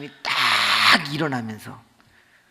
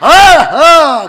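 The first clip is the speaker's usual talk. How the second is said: much higher, louder drawn-out martial cry; kiai shout; 12 semitones higher, 16 dB louder, much slower than usual. Retold or imitated. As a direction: let out a long fighting yell. Wife blocks blows.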